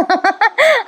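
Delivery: high bursting giggle; sudden delighted laughter; real laugh. Real, not acted. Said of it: "हसायला आलं मला".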